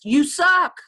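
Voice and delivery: a hint of a taunting inner voice; snide inner voice. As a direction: sneer the subtext at yourself. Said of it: "You know, subtext".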